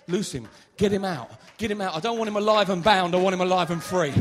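loud, building commanding preach; loud revival preaching; full-force preaching. Acted. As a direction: thunder the command to set him free.

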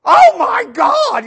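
high, slow, full shout; mimicked exclamation. Preacher quotes a common expression, loud mock-amazed shout. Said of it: "one of the most common expressions".

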